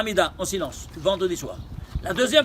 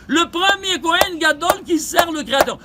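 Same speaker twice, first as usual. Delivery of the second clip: high, raised-voice declaration; emphatic proclamation; 7 semitones higher, 4 dB louder than usual. Real, not acted. Teacher reveals to students who Aaron is, proclaiming. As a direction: proclaim it to the whole room.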